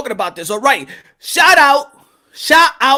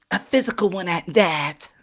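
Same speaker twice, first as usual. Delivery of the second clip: low, softer mock-seductive voice; hammed-up come-on, stretched out at the end; flirty admirer; 5 semitones lower, 6 dB quieter than usual. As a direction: proposition him quietly, voice dropped low. Acted fan flirts with Punch.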